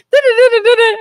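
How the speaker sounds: high, loud giggling da-da-da-da; laughing brain chatter. Retold or imitated. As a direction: mimic a racing mind through laughter.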